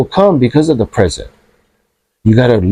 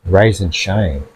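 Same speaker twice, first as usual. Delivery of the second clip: slow, softer "Rise and shine"; 6 semitones lower, 4 dB quieter, much slower than usual. Shown as a demonstration. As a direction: draw out "Rise and shine" gently and deliberately.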